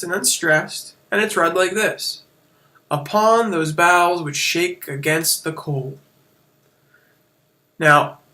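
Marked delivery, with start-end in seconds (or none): 2.9-6.0 slow, every second syllable of "Upon those boughs which shake against the cold" punched; iambic stresses. Shown as a demonstration. Teacher reading a line of iambic pentameter, heavy stresses hit. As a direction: read it weak-strong, slowly. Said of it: "I emphasized the bolded parts".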